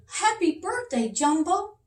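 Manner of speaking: high, joyful king; king's voice. Acted. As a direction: sing out the greeting as a happy king. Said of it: "he said".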